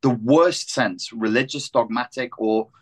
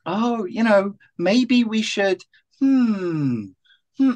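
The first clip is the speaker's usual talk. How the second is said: mocking dithering voice; 9 semitones higher, much slower than usual.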